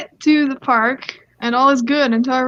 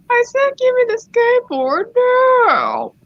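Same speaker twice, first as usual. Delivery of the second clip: entitled mom; 12 semitones higher, 3 dB louder than usual. Acted. Entitled mom repeats her demand to skater, high hammed-up insistence. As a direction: play an insistent, overbearing mother. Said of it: "here comes the voice actors".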